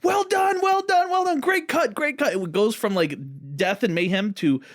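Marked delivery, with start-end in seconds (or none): 0.0-2.3 high shouted praise, jovial and excited; jovial shouted well done; imitated scream. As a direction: shout congratulations like a thrilled director, much higher. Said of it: "he just screams".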